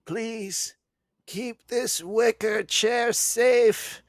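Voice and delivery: put-on high, slow, frail old woman pleading; dying old lady. Comedian voicing a dying neighbor's last wish, high and frail. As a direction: beg in a quavering old-lady voice.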